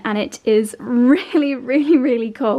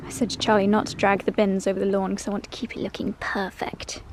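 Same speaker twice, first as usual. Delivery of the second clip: whispering; 5 dB quieter than usual. Real, not acted. Quiet aside about Charlie.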